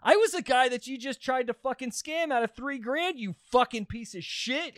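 high-pitched retold rant; unloading on him. Confronting the man who tried to scam him, unloading. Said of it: "And I just unloaded on him".

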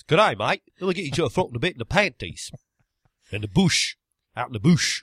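hammed-up Australian, calling out; mock Australian accent. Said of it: "is that Australian".

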